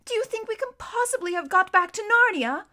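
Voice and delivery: high, loud, wondering question; eager high-pitched wonder; excited exclamation. A narrator's reading. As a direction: ask like an amazed little girl. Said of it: "exclaimed Lucy".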